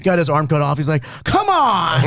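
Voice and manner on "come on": mock yell. Quoting a yell from movie.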